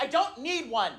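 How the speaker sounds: put-on high, emphatic child's refusal; high-pitched kid insistence; protesting daughter. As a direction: dig in like a stubborn little girl. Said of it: "My daughter's like".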